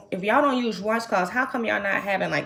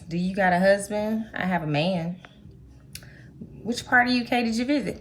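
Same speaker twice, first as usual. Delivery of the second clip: ordinary delivery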